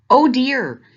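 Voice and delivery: dismayed chorus. A narrator's reading. Everyone reacting to crying moose.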